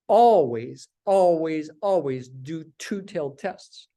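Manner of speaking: "always" repeated, the first loudest, then slowing; stress on always. Shown as a demonstration. Teacher describing cautious statisticians, stressing "always". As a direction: lean on each "always".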